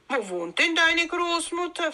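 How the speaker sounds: high-pitched, coaxing question; inquiring wolf. A narrator's reading. Wolf asking Rotkäppchen where Grandmother lives, inquiring.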